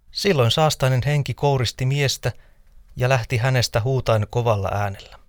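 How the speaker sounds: ordinary delivery, nothing marked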